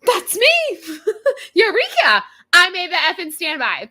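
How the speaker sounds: high, loud burst of triumph with a laugh; overjoyed. Retold or imitated. Standby passenger hearing her name called, shrieking with joy. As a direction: shout it like you just won the lottery.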